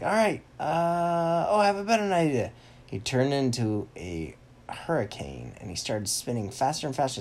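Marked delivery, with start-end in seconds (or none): 0.0-2.5 high, loud character voice; Turn Ninja. Acted. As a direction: stall loudly, then burst out with it. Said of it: "Turn Ninja's like".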